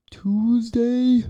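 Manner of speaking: squeaky high "Tuesday"; high comic voice. Acted. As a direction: pitch "Tuesday" way up, joking. Sudden high comic repeat.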